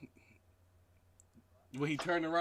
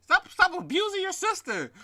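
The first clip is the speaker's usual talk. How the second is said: very high, softer grandma imitation; mock grandma scolding; 12 semitones higher, 7 dB quieter than usual. Friend acts out grandma scolding Donovan, mock grandma voice. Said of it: "how that first conversation went".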